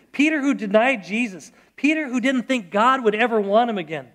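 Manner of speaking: ordinary talk, nothing marked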